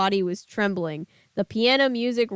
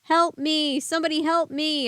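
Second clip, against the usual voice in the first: screaming for help; 6 semitones higher than usual. Acted. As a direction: scream over the noise. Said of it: "I cried, screaming over the roar".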